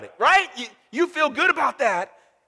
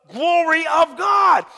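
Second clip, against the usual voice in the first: booming shout; 7 semitones higher, 4 dB louder, slower than usual. Real, not acted. Preacher booming the climax.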